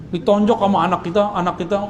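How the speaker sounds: ordinary talk, nothing marked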